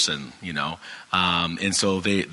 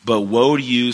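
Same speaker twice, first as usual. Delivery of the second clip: woe rings out louder and higher; forceful reading; 4 semitones higher, 7 dB louder than usual. Acted. Reading Jesus condemning the scribes, loud and high. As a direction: proclaim woe to the scribes, raised and strong. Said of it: "usually I would read this with more expression".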